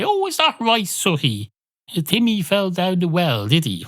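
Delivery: high, gushing mock voice; mock adult to puppet. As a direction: ham up talking to a puppet. Mocking people talking to Suhi.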